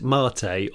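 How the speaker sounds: "Mate" said as MAR-tay; second name version. Shown as a demonstration. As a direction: say "Mate" in two syllables, long ah then tay. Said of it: "three versions of his name already".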